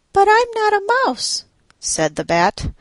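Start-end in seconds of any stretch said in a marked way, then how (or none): 0.1-1.4 high pleading bat. A narrator's reading. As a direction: pitch way up for the little bat.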